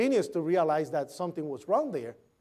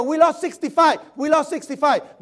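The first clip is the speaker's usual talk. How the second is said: higher, louder repeated radio alarm; urgent radio call; 7 semitones higher, 7 dB louder than usual. Retold or imitated. A radio voice reporting the lost floor, urgent and loud. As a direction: call it out like a frantic dispatcher.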